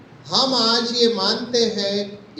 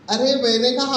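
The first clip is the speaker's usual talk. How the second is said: usual speaking voice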